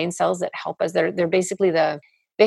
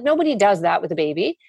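ordinary delivery

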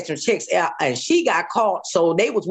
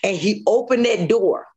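usual speaking voice

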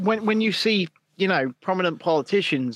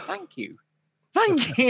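grateful thanks; 10 semitones higher than usual. Retold or imitated. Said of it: "I'm like".

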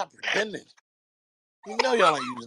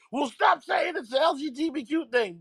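mock shout; 8 semitones higher than usual. Acted quote in argument.

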